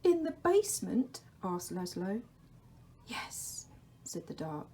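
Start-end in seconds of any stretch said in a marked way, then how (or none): 0.0-1.2 put-on high, timid child voice; a small boy asking up into the dark; Laszlo asking. Laszlo, woken, asks the Dark in a high voice. 3.1-3.7 a soft, unvoiced whisper; the Dark's whisper. The Dark calls to Laszlo in a whisper.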